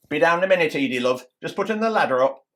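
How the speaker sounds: loud, high-pitched call down. Acted. Husband to Edie while putting the ladder up, calling out. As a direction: shout it down so someone below hears.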